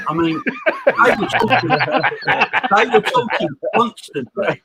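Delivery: high-pitched, laughing through the words; real giggly, broken-up delivery; laughing while talking. Real, not acted. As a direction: barely get it out through laughter. Joking about Zoom calls, cracking up.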